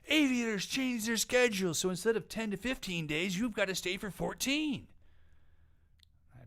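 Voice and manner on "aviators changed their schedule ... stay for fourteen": high mocking customer whine; mock complaint. Host quoting a customer's complaint in a high mocking whine. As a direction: lightly voice a grumbling customer.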